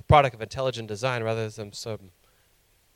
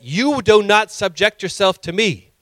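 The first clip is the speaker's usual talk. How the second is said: lightly acted stern commandment, louder; self-appointed god; 5 semitones higher, 5 dB louder than usual. Preacher voicing people who take God's place, sternly commanding. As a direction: hand down a mock divine decree. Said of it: "This is how people are talking".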